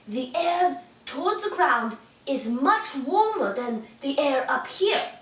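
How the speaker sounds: usual speaking voice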